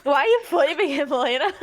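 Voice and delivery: high, loud, through laughter; real amused laughing protest; laughing question. Real, not acted. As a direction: call out to a friend while cracking up. Amused at friend yelling off mic.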